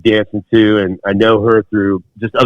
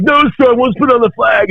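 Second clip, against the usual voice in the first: defiant yell. Retold or imitated. Recruit refuses the direct order, yelled defiance. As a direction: shout the refusal back.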